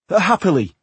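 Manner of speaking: said firmly, rising high. Acted. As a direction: insist firmly, no argument. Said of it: "he said firmly".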